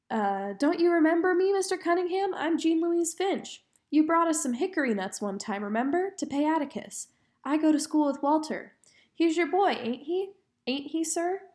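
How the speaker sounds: a hint of an eager child talking up; girl's voice.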